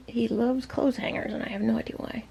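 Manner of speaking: hushed whisper to the viewer; whispered aside. Real, not acted. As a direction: lean in and whisper it like a secret. Aside about the parrot, unvoiced.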